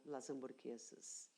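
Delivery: ordinary delivery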